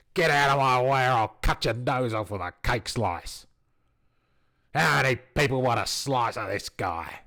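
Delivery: snarling raider yell, loud; an angry Mad Max Australian roaring threats; angry Australian shout. Acted. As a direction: bellow it like a furious wasteland warrior. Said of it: "they're all Australian".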